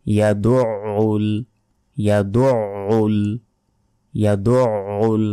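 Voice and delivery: ইয়াদুউল with a throaty ain, slow and drawn; correct demo. Shown as a demonstration. Teacher models the correct ain for students. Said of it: "আইনের উচ্চারণ গলার ভেতর থেকে".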